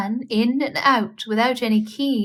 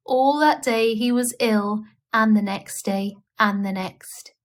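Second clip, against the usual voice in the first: usual speaking voice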